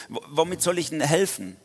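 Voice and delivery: hammed-up high protesting plea; pleading Gideon. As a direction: beg to be let off, as Gideon. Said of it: "Na, so, wieder so".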